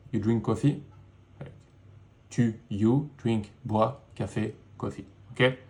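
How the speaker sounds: ordinary talk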